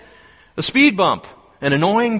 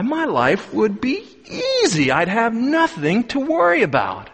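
envious viewer; 6 semitones higher than usual. Acted. TV viewer envies rich farmer.

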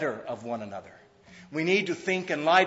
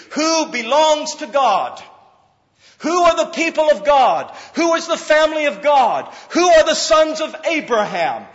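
thundering preacher; preaching at full force; 11 semitones higher, 11 dB louder than usual. Acted. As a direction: hammer each question home. Rhetorical preaching questions.

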